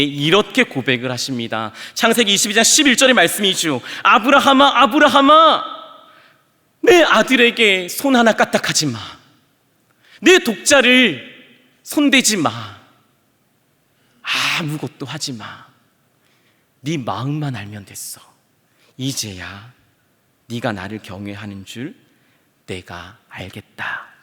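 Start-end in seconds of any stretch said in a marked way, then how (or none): 4.0-12.5 loud, high urgent cry; urgent outcry. Acted. God cries out to stop Abraham's knife, loud and high. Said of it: "마치 절규하시듯". 14.6-24.1 calm him gently; tender hush. Acted. God softly reassures Abraham that he has seen his heart. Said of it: "하나님이 말씀하십니다".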